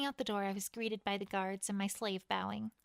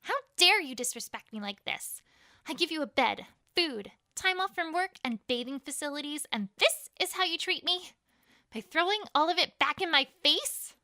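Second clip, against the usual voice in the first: loud, higher, outraged rant; furious scolding; 5 semitones higher, 7 dB louder than usual. Acted. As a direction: tear into the prisoner with contempt. Queen berates prisoner.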